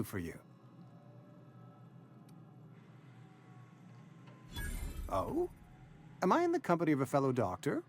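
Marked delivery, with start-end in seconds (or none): 5.1-5.5 high, rising questioning oh; intrigued surprise. Acted. Doctor hears of a possible fellow doctor, intrigued surprise. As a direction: let it lift sharply into a question.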